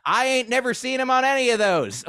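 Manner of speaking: high, mocking heckler voice. Audience guy heckles the comic's intro, high and scoffing. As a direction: jeer from the crowd. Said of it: "a guy in the audience was like".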